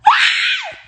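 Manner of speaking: a sudden high cry, hammed up; mock crying shriek; mock wail. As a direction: wail out like you're sobbing. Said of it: "I started back crying because I'm just like".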